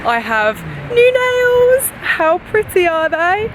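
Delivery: high, slow, sing-song show-off; delighted reveal. Real, not acted. Vlogger thrilled showing her new nails, high and drawn out. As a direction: present the nails proudly.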